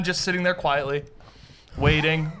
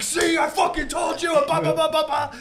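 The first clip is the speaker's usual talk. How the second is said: high-pitched gloating outburst; hammed-up vindicated shout, trailing into babble; mock yelling; 9 semitones higher than usual. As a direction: imitate a friend yelling in triumph. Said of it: "they're yelling at each other".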